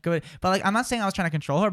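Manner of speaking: usual speaking voice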